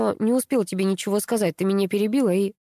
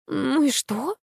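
higher-pitched, dismissive question; unconcerned; 7 semitones higher, slower than usual. A narrator's reading. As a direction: shrug it off with a lifted pitch. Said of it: "не заволновалась Капа".

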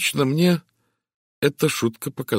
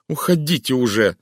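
louder, higher shout; 6 semitones higher, 6 dB louder than usual. A narrator's reading. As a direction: throw them out with a yell. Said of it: "закричала Тереза".

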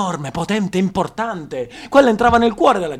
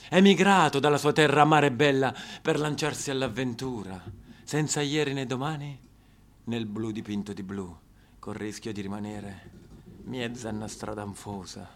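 much lower and much softer, drifting off; hushed dreamy reverie; 8 semitones lower, 7 dB quieter than usual. Acted. Recalling [name]'s flight from home, fading to a hush. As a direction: let the voice sink away as if dreaming. Voice sinks to dreamy near-whisper.

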